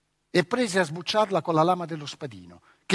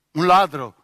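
indignant echo, louder; offended; 10 dB louder than usual. Acted. Boy stung at being called thief, offended echo. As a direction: throw the word back, stung. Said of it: "fece Cosimo offeso".

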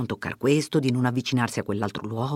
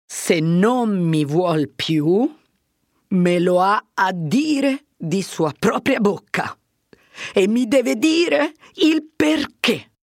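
slow, close to crying, rising high at the end; a man fighting not to weep; holding back tears; 5 semitones higher, 4 dB louder, slower than usual. Acted. Fermo, near tears, demands Lucia tell him herself. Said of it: "avrebbe pianto se gli uomini non si vergognassero".

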